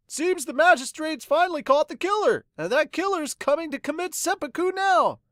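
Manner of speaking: high, loud chatter of a village woman; breathless town gossip; gossiping townswoman. Acted. Townswoman gossiping about the killer, high excited chatter.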